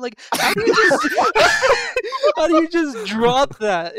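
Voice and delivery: high, loud, laughing as he asks; cracking up at how absurd it is; laughing disbelief. Real, not acted. Recapping the morgue scene to friends, laughing in disbelief.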